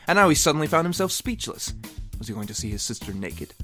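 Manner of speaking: usual speaking voice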